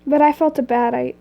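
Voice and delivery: plain reading voice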